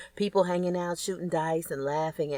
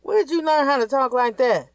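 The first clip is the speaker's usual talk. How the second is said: scolding mom; 9 semitones higher, 7 dB louder than usual. Acted. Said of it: "her mom asked her".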